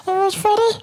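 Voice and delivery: very high squeaky giggle at its own joke; high voice laughing. Retold or imitated. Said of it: "this is my voice that I use to calm myself down".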